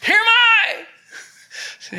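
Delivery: high, drawn-out urgent shout; completely different tone. Shown as a demonstration. Preacher imagining Abraham answering the angel, high loud cry. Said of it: "his voice tone was completely different".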